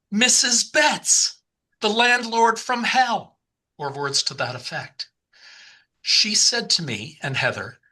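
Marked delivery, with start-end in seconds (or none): 0.1-3.3 high, slow, drawn-out exclamation; exclaimed realisation. Retold or imitated. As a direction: call it out as a shared revelation. Both blurt out the same realisation.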